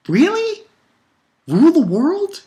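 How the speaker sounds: high-pitched, scoffing questions; incredulous. Real, not acted. Reviewer scoffing at the villain's ambition, incredulous. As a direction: squeak it up in disbelief.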